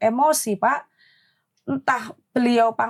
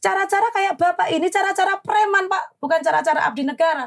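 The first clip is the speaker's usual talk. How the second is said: higher, heated accusation; retold angry scolding; 7 semitones higher than usual. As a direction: call him a thug to his face. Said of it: "ini mulut saya sudah jelek". (menuduh dengan nada tinggi dan marah)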